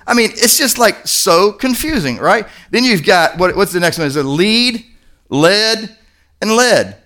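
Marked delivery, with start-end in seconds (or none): 4.2-4.8 long ee in lead; lead as leed. Shown as a demonstration. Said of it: "They all have different meanings". 5.3-5.9 short e in lead, like the metal; lead as led. Shown as a demonstration. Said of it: "They all have different meanings". 6.5-7.0 short e in lead, ending the list; lead as led. Shown as a demonstration. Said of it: "They all have different meanings".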